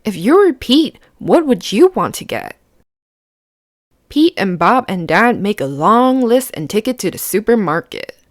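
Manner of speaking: plain reading voice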